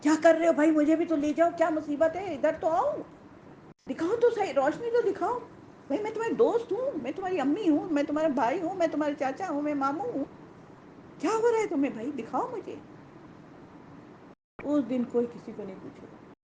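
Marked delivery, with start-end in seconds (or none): 0.0-2.9 high-pitched, urgent pleading call; acted cry for help, pitched up; hypocrites calling out. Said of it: "منافق مومنوں کو پکار کر کہیں گے". 3.9-12.8 much higher, insistent begging voice; acted high-pitched appeal to loved ones; hypocrites pleading. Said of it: "منافق مومنوں کو پکار کر کہیں گے".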